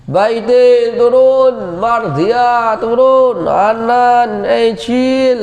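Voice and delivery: loud, high, slow calling down the hall. Retold or imitated. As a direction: call the students down, drawn out. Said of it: "setiap hari teriak-teriak".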